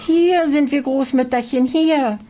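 put-on high, loud, excited child's call; loud, high-pitched gleeful shout; joyful calling out. A narrator's reading. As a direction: burst out calling to grandmother. Girls jump out, calling grandmother.

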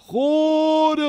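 high, loud bellow. A narrator's reading. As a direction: bellow the name across the woods. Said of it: "brüllte der sechsköpfige Drache".

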